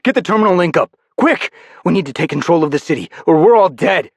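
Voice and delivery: high, loud, rushed orders; urgent. Acted. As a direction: hurry him, panic rising. Urgent order as city falls.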